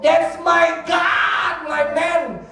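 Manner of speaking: loud, high, fervent preaching; real shouted declaration of faith; triumphant proclamation. Real, not acted. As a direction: proclaim it at full voice with drawn-out emphasis. Proclaims his faith at full force.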